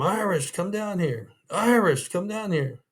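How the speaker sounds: high calling out a name across the cells; retold yelling. Inmate calling the guard from his cell, high mock yell. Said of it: "he's yelling my name".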